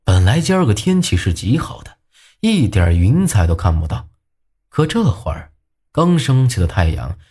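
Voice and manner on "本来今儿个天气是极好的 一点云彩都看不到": ordinary delivery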